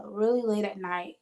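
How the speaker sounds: ordinary delivery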